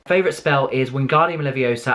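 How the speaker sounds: ordinary delivery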